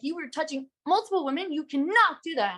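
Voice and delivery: high-pitched indignant telling-off; retold full rage. Woman confronting the man about several women, retold rage. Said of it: "full rage".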